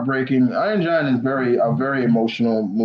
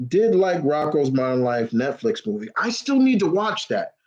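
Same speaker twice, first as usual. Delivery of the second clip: ordinary talk, nothing marked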